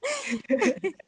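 high, loud burst of laughter. Real, not acted. Laughing after forgetting what she meant to say, a high giggle. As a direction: break into a real giggle.

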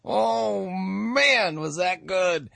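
laughs, then a high, rapturous "oh man"; delighted laughing. Real, not acted. Host reacting to the sermon he just played, laughing with delight. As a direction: savor it with real, giddy delight.

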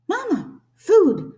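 loud, high hungry peep; piercingly high little chick cry; tiny gosling voice. A narrator's reading. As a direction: demand it like a hungry baby bird. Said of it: "That tiny voice".

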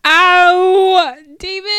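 high, drawn-out tearful cry; overcome wail. Real, not acted. Fan missing David, high tearful wail. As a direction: wail it, then laugh-cry the name. Crying out over missed character.

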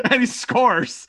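laughing, high and loud disbelief; excited laughing. Real, not acted. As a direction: burst out laughing in joy. Team scores, excited.

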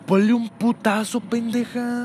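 high, slow, strained mom voice; fed-up mom. Retold or imitated. Relative urging the mother to smack the crying kid. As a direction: drag it out like an exasperated relative.

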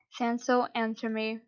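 plain reading voice

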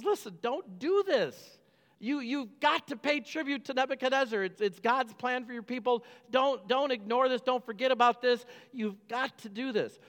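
high, earnest prophet's plea; pleading prophet. Retold or imitated. Jeremiah urging the stubborn king to pay tribute, pleading high.

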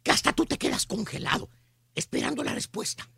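low, near-whispered storytelling; suspenseful hush. Acted. Host describing the frozen silence in the room, suspenseful hush. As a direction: drop to a hush and hang on the moment. Mimics hush awaiting the answer.

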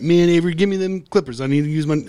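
lightly voiced southern coworker asking; southern accent. Coworker asking for clippers, a light southern accent. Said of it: "he's got a southern accent".

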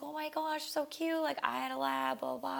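high, bubbly gushing; telling the owner about her old lab, high and gushing. Retold or imitated. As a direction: coo over the puppy, voice pitched high. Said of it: "I look up at him and I was like".

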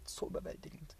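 fades to a bare whisper; soft whispered echo of the first. Real, not acted. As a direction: let the repeat dissolve into breath. Repeats word, voice sinking away.

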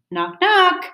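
high, bright knock knock; knocking sound. Acted. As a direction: call out the knocks playfully. Reading a story, door knock.

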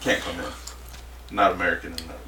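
a flat low bouncer brush-off; imitating the bouncer. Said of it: "He goes".

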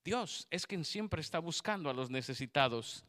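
normal talking voice

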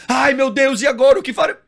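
high, loud hammed-up panic; mock panic. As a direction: overact the despair, shrieking. Imita pânico exagerado. (pânico fingido e exagerado)

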